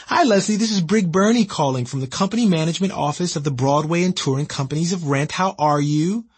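high upbeat office voice; cheery caller. Retold or imitated.

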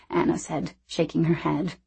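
plain reading voice

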